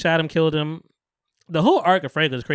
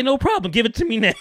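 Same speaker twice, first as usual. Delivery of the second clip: eager mock demand; 9 semitones higher, 4 dB louder than usual. Acting Franklin grabbing the money.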